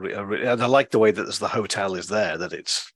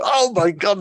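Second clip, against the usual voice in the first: loud, high exasperation; acting out worn-out patience; frustrated; 10 semitones higher, 5 dB louder, slower than usual. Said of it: "the bunny's getting frustrated".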